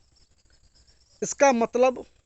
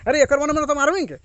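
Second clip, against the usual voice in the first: mock threat; 6 semitones higher, 5 dB louder, faster than usual. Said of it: "कभी बोलते हैं".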